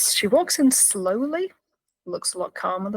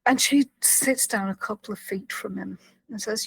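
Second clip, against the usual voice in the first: usual speaking voice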